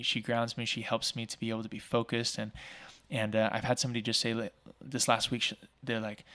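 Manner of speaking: normal talking voice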